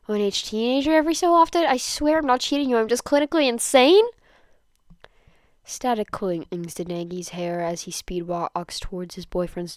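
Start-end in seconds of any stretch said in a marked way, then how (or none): 0.1-4.0 high, loud, desperate rush; frantic rehearsal. Acted. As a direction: blurt the excuse in a panic. Denki rehearses frantic apology.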